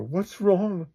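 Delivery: high-pitched worried question; high, anxious cry; alarmed character. Acted. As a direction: ask it with rising alarm. Acts alarmed characters at the door.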